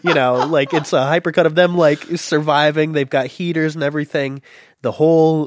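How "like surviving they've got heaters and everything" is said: usual speaking voice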